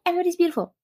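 high, giddy mock squeal; freaking out. Baby gays in a club corner, high giddy freak-out. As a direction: squeak it like an overwhelmed newcomer. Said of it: "Freaking, freaking, freaking out".

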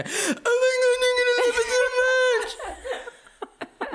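very high, slow, gushing fake excitement; mock an over-excited tourist; gushing mock enthusiasm. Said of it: "that thing that people do".

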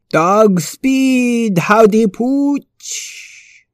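calling out. Acted. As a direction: stretch it slow, calling after him. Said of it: "Fred called after him".

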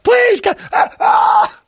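high, loud plea breaking into a scream; beg, then scream in pain; imitated screaming. Suspect screaming while cuffed with the dog on him, loud scream. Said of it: "Just screaming".

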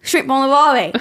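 broad mock Australian catchphrase; hammed-up Australian accent. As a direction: put on a badly faked Australian twang. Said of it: "I won't butcher it".

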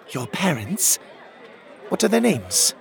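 lightly voiced puzzled woman, lifting in pitch; a hint of a bewildered young woman. A narrator's reading. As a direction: ask as a puzzled passer-by.

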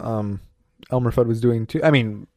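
ordinary delivery

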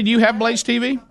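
ordinary talk

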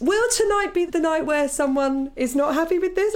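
high-pitched anxious self-questioning; nervous inner thought. Acted. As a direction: act out pre-show dread, pitched well up. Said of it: "my bum squeezed up".

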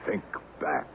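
hushed, breathy whisper. Acted. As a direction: whisper it like a spell. Eerie hypnotic command.

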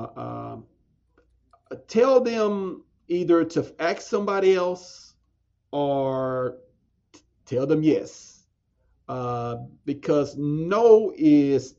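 ordinary talk, nothing marked

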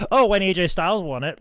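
high, loud, quick mock quote; mocking imitation. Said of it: "you would be like".

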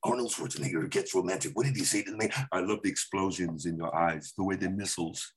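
put-on low Austrian action-star accent, lightly done; Arnold Schwarzenegger. Comic does an Arnold impression on a podcast, low Austrian accent. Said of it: "you gotta change it up and go".

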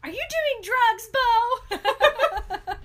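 high, loud alarmed father; panicked dad. Retold or imitated. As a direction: shriek it like a worried dad. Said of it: "Charlie goes".

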